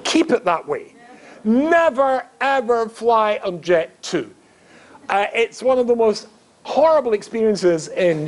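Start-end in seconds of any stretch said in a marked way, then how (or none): 1.4-4.3 NEVER pitched high and drawn out with EVER; slow, insistent warning, leaning on NEVER; emphatic warning. Real, not acted. Rabbi warning the audience off a cheap airline, emphatic.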